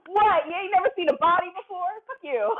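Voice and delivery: loud, high-pitched indignant comeback; mock outrage at being stared at; brash retort. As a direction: fire it back loudly, high and quick. Acts out brash reply in character.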